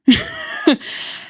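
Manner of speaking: a high, breathy nervous laugh; nervous laughter. Real, not acted. Telling an uncomfortable memory, a nervous breathy laugh. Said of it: "pardon my nervous laughter".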